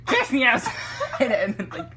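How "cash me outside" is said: loud, higher catchphrase with a laugh; catchphrase demo. Shown as a demonstration. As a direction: throw out the meme line for a friend to copy. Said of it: "Can you please just say".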